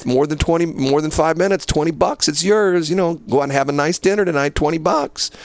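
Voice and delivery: goading. Retold or imitated. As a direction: dangle the bet, pushing her to accept. Goading her into the bet.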